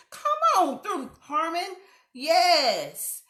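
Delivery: a loud, high cheer stretched out slow; hype cheer. Real, not acted. Hyping up Jasmine's self-praise, loud high cheer. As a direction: cheer her on like she's walking a runway.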